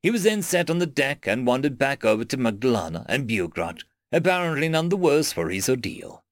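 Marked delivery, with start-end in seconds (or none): none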